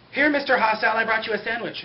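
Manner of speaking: put-on high, loud, eager kid; a child offering a prisoner food brightly; young boy voice.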